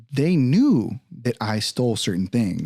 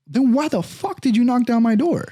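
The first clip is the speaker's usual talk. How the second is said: angry retort; 12 semitones higher, 3 dB louder than usual. Retold or imitated. Arguing with the detective.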